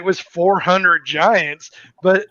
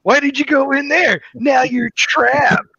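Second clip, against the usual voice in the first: Bruce; 5 semitones higher than usual. Acted. Player voicing Bruce springing the trap on the party, mock gloating. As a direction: gloat over the trapped fools, hammed-up. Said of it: "Bruce is like".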